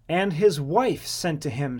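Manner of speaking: plain reading voice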